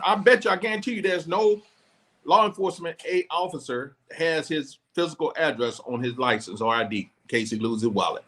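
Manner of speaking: ordinary delivery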